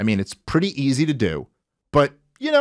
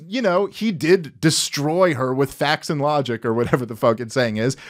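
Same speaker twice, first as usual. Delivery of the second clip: ordinary talk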